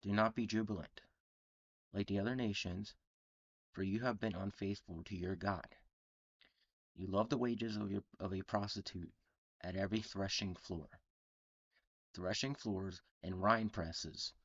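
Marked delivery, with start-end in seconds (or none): none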